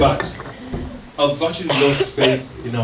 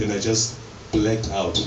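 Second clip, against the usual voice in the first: ordinary talk, nothing marked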